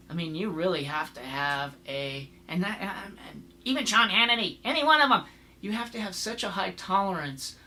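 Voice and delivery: usual speaking voice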